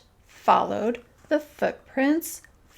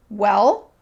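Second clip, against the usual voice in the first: loud challenging opener; fierce; 9 dB louder than usual. A narrator's reading. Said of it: "said Ernest fiercely".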